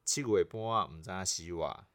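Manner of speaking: saying the slang in Taiwanese Hokkien. Shown as a demonstration. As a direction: Taiwanese Hokkien proverb, clearly recited. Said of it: "这个俚语台语就是这样讲".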